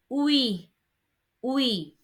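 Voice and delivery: "oui" as one gliding wee sound; UI glide. Shown as a demonstration. Teacher showing what U I gives, "oui" as a glided wee. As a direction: model "oui" slowly as a single glide. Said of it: "coming together gives you".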